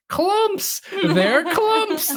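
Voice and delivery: very high, laughing squeal of "Clumps!"; retelling a cartoon girl squealing "Clumps!" with laughter; excited squeal. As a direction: shriek "Clumps! They're clumps!" in giddy delight. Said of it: "And she goes".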